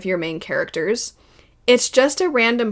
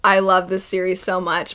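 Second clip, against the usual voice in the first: ordinary talk, nothing marked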